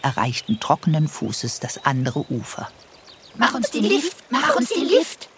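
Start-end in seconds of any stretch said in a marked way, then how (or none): 3.4-4.2 higher little mouse call. Acted. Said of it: "riefen die Mäuse". 4.3-5.3 very high, squeaky mouse call. Acted. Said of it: "riefen die Mäuse".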